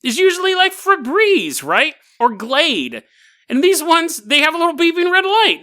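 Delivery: mock know-it-all David. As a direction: play the self-satisfied expert exposing fakes. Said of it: "he goes".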